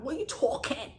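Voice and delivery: exaggerated New Jersey vowel on "talking"; New Jersey accent. Shown as a demonstration. Vlogger retrying her New Jersey accent for viewers. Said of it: "my New Jersey accent wanted to come out".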